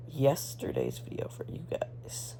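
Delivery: soft whispered aside; whispering. Real, not acted. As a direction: whisper it to the viewers. Aside to viewers, unvoiced.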